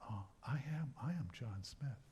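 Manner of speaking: quiet realization. Acted. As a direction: say it softly, as if finally remembering yourself. Actor finally recognizes himself.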